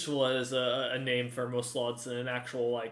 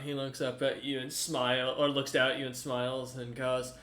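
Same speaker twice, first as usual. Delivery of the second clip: usual speaking voice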